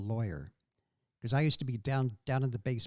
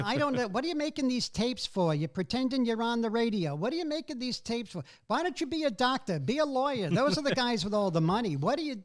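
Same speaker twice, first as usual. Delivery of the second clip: exasperated mother, pitched higher; nagging mom; 7 semitones higher than usual. Retold or imitated. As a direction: mom baffled by his radio tapes. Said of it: "my mother would say to me".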